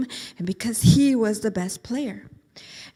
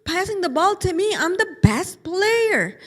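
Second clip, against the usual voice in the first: much higher, near tears at the end; bewildered kid about to cry; upset whine; 7 semitones higher, 4 dB louder than usual. Acted. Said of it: "And Eric's like".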